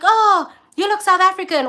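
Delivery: mock eager stranger. Imitating Nigerians approaching her abroad, mock-eager high voice. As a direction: imitate a stranger fawning over them. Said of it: "they'll be like".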